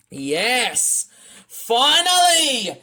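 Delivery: high, drawn-out exclamation; relieved cheer. Real, not acted. Relief that it finally works.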